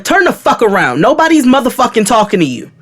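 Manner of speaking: high, heated dismissal; hot, retold anger. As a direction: re-enact telling a stranger to back off. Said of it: "I'm hot".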